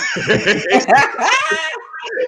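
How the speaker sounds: high, shrieking burst of laughter. Real, not acted. Cracks up at own story.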